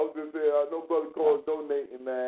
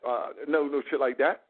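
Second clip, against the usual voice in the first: ordinary talk, nothing marked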